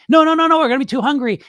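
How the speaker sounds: loud, high burst of inner alarm; panicked protest. Acted. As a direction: cut in as the hungry inner voice.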